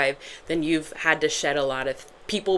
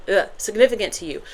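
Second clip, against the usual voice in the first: ordinary delivery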